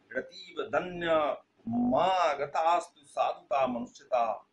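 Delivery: slow, drawn-out Sanskrit verse chanting; verse recitation. Acted. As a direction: declaim the verse slowly with stretched syllables. (लय में धीमा श्लोक पाठ)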